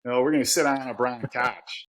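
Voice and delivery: Yinzer accent, "brown couch" with flat ah vowels; broad Yinzer vowels on "brown couch". Shown as a demonstration. Showing how Pittsburgh coworkers talk, Yinzer accent. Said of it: "it sounds like this".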